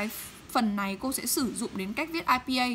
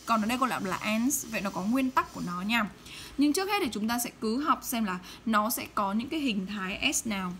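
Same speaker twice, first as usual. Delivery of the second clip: ordinary talk, nothing marked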